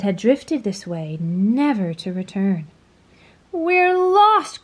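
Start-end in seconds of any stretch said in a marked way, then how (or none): 3.5-4.6 high, loud frightened cry; scared earthworm crying out. A narrator's reading. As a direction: wail it in panic. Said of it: "cried the earthworm".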